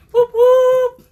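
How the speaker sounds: high, loud cheering whoop; excited whoop. Real, not acted. Crafter cheering a viewer who joined the livestream, loud and excited. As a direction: cheer her on at full volume.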